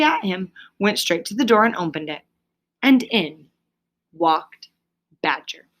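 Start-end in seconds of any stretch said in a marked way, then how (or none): none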